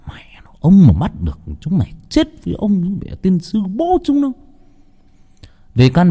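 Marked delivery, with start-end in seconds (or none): 0.1-4.3 hissed curse. Acted. Father hiding, cursing under breath.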